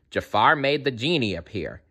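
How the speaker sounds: ordinary delivery, nothing marked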